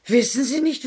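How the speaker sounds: ordinary talk, nothing marked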